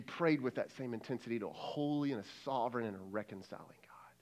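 low, soft, near-whisper; hushed reverence. Real, not acted. Preacher urging prayer to a holy God, hushed. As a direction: drop into a reverent hush.

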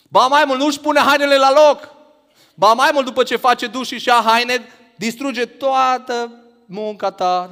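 loud, high, exasperated complaint, slowing at the end; exasperated outburst. Acted. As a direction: rant like a fed-up wife whose work was ruined. Wife's frustration at messy husband.

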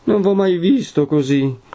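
high, tearful dismay; struggling through sobs. A narrator's reading. Lucia shocked by her betrothed, high and tearful. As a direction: force it out tearfully. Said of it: "a stento tra i singhiozzi".

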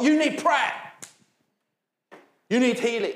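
loud, high, pushy demand; pushy, not gentle. Pretend. Street preacher pushing prayer on strangers, loud and pushy. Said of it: "It's gently going up to people".

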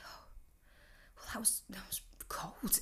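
soft whispered aside; hurt whisper. Real, not acted. To her viewers after the brush-off, soft hurt whisper. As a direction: whisper it like a wounded secret.

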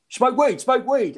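mock urgent call, pitched high; retold urgent call. Bloke to comic by the doors, urgent high call. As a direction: imitate a bloke urgently getting attention. Imitating bloke calling over to him.

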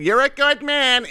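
high, slow mock praise of a stranger; drawn-out approving voice, a bit sarcastic; mock guy in line.

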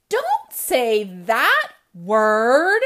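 loud, very slow refrain; comic refrain shout. A narrator's reading. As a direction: drag out the refrain loudly.

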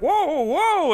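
high, loud panicked whoa; the driving examiner freaking out as the car speeds; mock panicked lady. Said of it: "the lady's freaking out".

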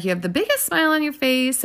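high, admiring gush; shower her with compliments; gushing lady. Retold or imitated. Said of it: "she's like".

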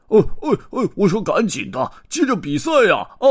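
high, loud frantic yelling; frantic shout. Acted. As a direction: bang on the box and yell. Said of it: "拍着盒子大喊". (着急地高声大喊)

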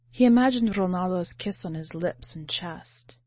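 plain reading voice

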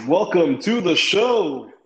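big game-show host welcome, words stretched out; announcer voice. Shown as a demonstration. Podcast host opening his first show, announcer voice. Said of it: "an announcer voice".

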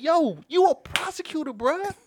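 high-pitched disbelieving appeal; incredulous protest. Retold or imitated. Scolding the prosecutor for her showing, incredulous protest. Retold incredulous reaction to Harris.

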